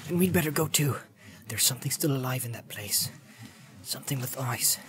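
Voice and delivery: hushed warning. Acted. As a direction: warn him under your breath.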